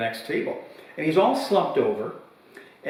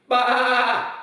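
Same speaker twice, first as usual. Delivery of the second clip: dismissive bah; 11 semitones higher than usual. Retold or imitated. Retold dismissive reply.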